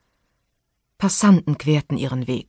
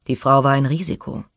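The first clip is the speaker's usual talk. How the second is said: ordinary delivery, nothing marked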